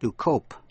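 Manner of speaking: cope with the "oh" vowel; long O. Shown as a demonstration. Teacher shows learners cope pronounced with a long O. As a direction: say cope with O as in the letter's name. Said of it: "Âm O đọc là O".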